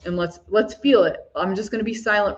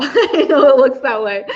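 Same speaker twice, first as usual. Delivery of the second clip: laughing out loud through the words, higher and louder; 6 semitones higher, 7 dB louder than usual. Real, not acted. To viewers who asked about her drink, laughing. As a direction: let the real laugh carry the line.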